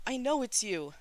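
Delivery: high, loud angry outburst; roar. A narrator's reading. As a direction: roar it at your brother in anger. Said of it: "Shi Qingxuan roared".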